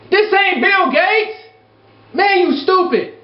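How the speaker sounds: high, slow put-down; scornful retort. Real, not acted. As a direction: slap the idea down. Scolding imagined emailers.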